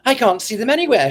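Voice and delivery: quick, mildly panting words; a touch of running breathlessness, hurried. Acted. Young lady back from running after the dancers, lightly panting. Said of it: "she declared pantingly".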